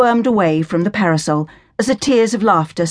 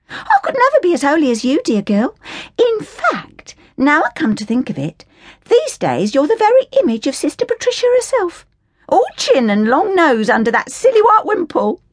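higher, mocking, cheeky voice; teasing Cockney girl; 10 semitones higher than usual. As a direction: needle your pious friend in a high mocking tone. Hilda teases Flora mockingly.

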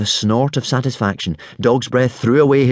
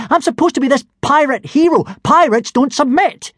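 very high-pitched indignant protest; 4 dB louder than usual. A narrator's reading. Boy insists he is the pirate hero, indignant. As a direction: squawk your outrage as a wronged young hero. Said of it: "said Hiccup indignantly".

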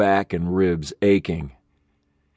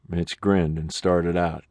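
plain reading voice

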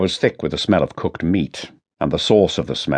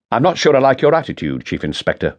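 snapped; 6 semitones higher than usual. Acted. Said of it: "he snapped".

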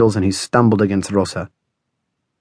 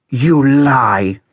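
higher, forceful angry snarl; Rosa snarling; 4 semitones higher, 4 dB louder than usual. A narrator's reading. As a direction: snarl the accusation, pitched up. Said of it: "snarled Rosa".

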